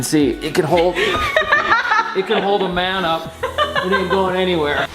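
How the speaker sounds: laughing through the words; giggling while talking. Real, not acted. Laughing while showing strong beam.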